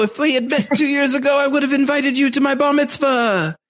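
high-pitched mock lament; hammed-up, high, heartfelt regret; effusive boyish host. Acting out effusive boyish Roberto.

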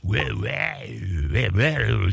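lightly imitated creature growl; drawn-out wah like a grumbling beast; grumbling monster. Speaker imitates cartoon giant monster or alligator, grumbling.

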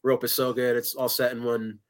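ordinary delivery

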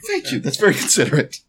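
high-pitched, earnest thanks; genuine thanks. Acted. Character thanking companions for being considerate, high and genuine. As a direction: lift the thanks high, then quicken. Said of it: "he says genuinely".

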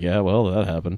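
bored, unbothered shrug of a line; indifferent. Retold or imitated. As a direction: say it like nothing matters. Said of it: "sort of like a dick".

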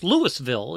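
LOUISVILLE sounded as written, Lewis-ville; spelled-out pronunciation. Shown as a demonstration. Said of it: "is how it's spelled out".

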